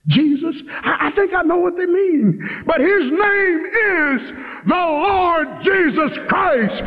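high, insistent preacher's cry, long stretched words; impassioned preaching. Real, not acted. Preacher correcting folk who just say Jesus, impassioned.

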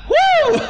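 very high whooping cry; imitated whoop. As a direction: let out a sudden high whoop. Imitates convert's shout.

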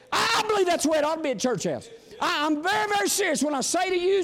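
fervent pulpit preaching, rising and falling. Acted. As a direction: preach it with full force to the congregation. Back to preaching.

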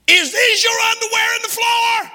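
high, piercing mock yell of a woman; scolding shout. Preacher imitating women at home finding underwear, shouting. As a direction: yell it like a fed-up housewife. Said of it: "I can hear the women now".

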